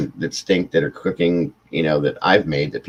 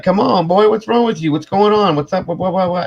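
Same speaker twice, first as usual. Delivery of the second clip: much higher, louder Southern-dad voice; a pitched-up dad asking what's wrong; friend's dad; 11 semitones higher, 4 dB louder than usual. Retold or imitated. Friend's dad asking the holding-breath boy what's wrong, high hearty voice.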